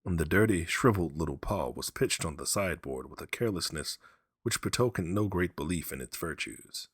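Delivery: ordinary delivery, nothing marked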